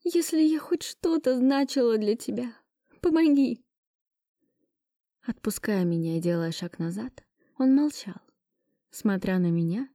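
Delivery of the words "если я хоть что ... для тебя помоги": high, rising cry for help; desperate plea. Acted. Heroine's last plea.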